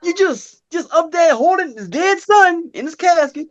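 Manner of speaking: mock high incredulous. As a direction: squeak it out in disbelief. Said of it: "I was like".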